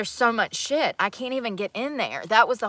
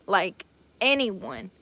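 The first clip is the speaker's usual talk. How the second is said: slow emphatic; much slower than usual. Acted. Stretching out the protest.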